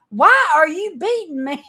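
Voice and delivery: high, loud indignant donkey voice; a put-upon donkey protesting; talking donkey. Acted. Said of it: "the donkey turned around and said".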